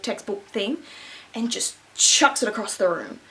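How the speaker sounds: ordinary talk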